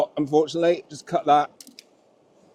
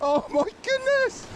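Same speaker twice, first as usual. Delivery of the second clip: awed squeal; 8 dB quieter than usual. Real, not acted. Awed at the size of the fish.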